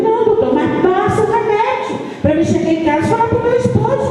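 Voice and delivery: high, urgent pleading with the doctor. Retold or imitated. Mother begs the doctor for medicine.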